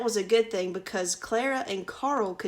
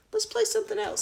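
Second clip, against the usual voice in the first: put-on much higher chirpy child voice; bright child; 13 semitones higher, faster than usual. Carl urges Clara to play something else, very high. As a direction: squeak it up high like an eager boy.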